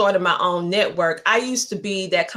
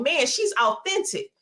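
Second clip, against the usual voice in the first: high, fast gushing fan voice; squealing high fan imitation; imitating fans. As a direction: gush like an excited fan, pitched way up. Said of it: "they were saying".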